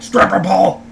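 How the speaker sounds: lightly mocked president impression; Nixon. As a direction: imitate someone's politician impersonation, swearing substitute. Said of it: "he does his Nixon impersonation".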